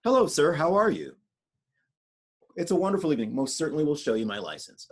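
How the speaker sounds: polite, carefully articulated voice for the police; extra-courteous, over-correct diction. Shown as a demonstration. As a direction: enunciate respectfully, as if to an officer. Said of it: "my police voice is".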